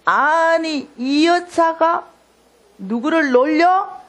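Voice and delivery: drawn-out, high-pitched imitation of indignation; angry creditor. Creditor to the debtor over a ten-thousand-won bill, acted indignant anger. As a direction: act out slow, rising outrage at being mocked. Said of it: "이 사람이 화가 너무가 났어".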